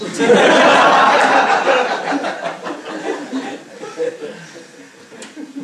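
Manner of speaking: big high laugh bursting out; loud burst of laughter. Real, not acted. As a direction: let a loud laugh break out. Laughs at Kamsa's rage.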